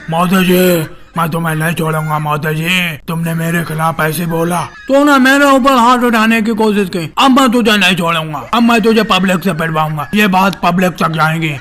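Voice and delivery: hammed-up angry actor impression; vengeful film hero. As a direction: threaten like an outraged movie hero.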